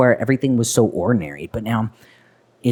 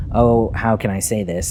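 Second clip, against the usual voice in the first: ordinary talk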